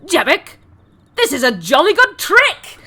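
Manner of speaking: high, chirpy posh rabbit-folk exclamation; Harengon NPC voice. Acted. Rabbit-folk cheering at a clever trick, high and jolly. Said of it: "he goes".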